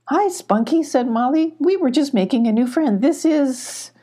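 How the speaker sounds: high cheerful kitten voice; a little cat greeting a friend. A narrator's reading. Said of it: "said the goat".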